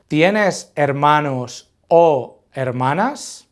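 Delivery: slow Spanish question, rising on "hermanas"; question intonation. Shown as a demonstration. Teacher modelling the brothers-or-sisters question slowly, with rising question intonation on "hermanas". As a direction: draw it out slowly and lift "hermanas" at the end. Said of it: "we'll just intonate it like a question".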